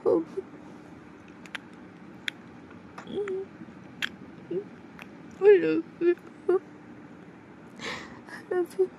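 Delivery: high and soft, fading; a girlfriend confessing love through tears; soft high voice, trailing away; tender confession. Acted.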